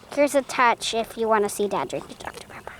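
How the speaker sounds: soft whispered retake; whispering. Acted. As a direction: whisper the intro line. Redo of the intro, whispered.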